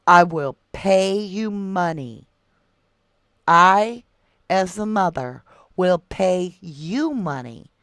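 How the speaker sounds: slow, loud stress on "pay you money"; emphatic vow. Real, not acted. Mother pleading for help finding a missing child, emphatic vow. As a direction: punch "pay you money" slowly and forcefully.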